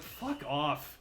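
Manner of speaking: slow, dragged-out exasperation; drawn-out fuck off. Real, not acted. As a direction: stretch every word, fed up. Venting about Undertale fans.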